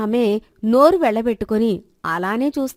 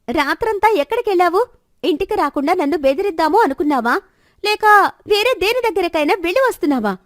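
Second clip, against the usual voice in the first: loud, high, furious scolding; wife screaming at her husband in fury; shaking with rage; 7 semitones higher, 4 dB louder than usual. A narrator's reading. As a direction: yell at the husband who stayed out all night. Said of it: "కోపంతో ఊగిపోతూ పెద్ద గొంతుతో".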